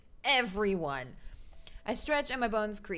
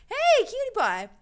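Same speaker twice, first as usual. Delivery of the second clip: high and loud delight; excited squeal; 13 semitones higher, 9 dB louder than usual. Real, not acted. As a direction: squeal at a cute guy. Reacting to game character.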